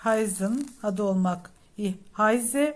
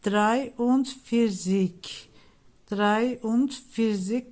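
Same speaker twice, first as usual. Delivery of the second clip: slow careful pronunciation; much slower than usual. Shown as a demonstration. Teacher models German number.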